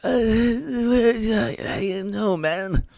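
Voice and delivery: put-on high, quiet, strained old man mumble; a high, faint mumble with a whimper in it; mumbling Biden impression. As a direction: play a lost, tired old president. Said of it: "He was like".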